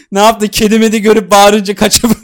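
can barely get it out for laughing. Real, not acted. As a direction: tease through real bursts of laughter. Cracking up while mocking the friend.

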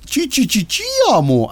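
high squeaky servant voice; Esclavo voice. Retold or imitated. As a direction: squeak it up like a cartoon sidekick. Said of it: "así hablaba".

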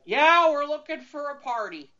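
put-on loud, high, sloppy drunk blurt; drunk passenger. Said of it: "the guy... drunk out of his gourd".

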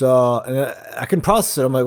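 ordinary delivery

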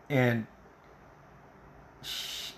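ordinary delivery

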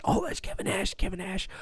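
hushed, whispered buzz of recognition; excited whisper. Retold or imitated. Onlookers recognizing the wrestler in line, excited whisper. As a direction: imitate a whisper passing down the line. Onlookers passing the name along.

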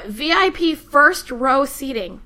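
ordinary delivery

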